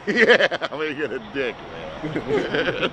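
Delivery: a hint of the laid-back stoner character; Arlo. Actor slipping into his stoner character, lazy and agreeable. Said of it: "me in perfect Arlo, uh, pre-Arlo form".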